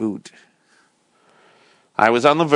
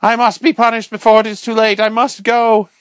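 much higher, louder frantic shout; still shouting; 9 semitones higher, 4 dB louder than usual. Acted. The curate shouts before lunging for the door. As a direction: keep yelling like a man possessed. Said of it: "shouted the curate at the top of his lungs".

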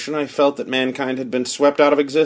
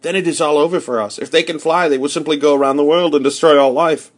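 raised, despairing voice running on; fearful outcry; 3 semitones higher, 4 dB louder than usual. A narrator's reading. Narrator realises flying Martians mean the end, fearful outcry. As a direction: let the horror pour out. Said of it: "I cried".

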